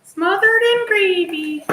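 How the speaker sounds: sing-song it like a TV chef; hammed-up cooking show line; playful put-on voice. Repeats phrase in put-on voice.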